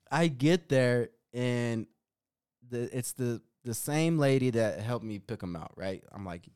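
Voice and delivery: usual speaking voice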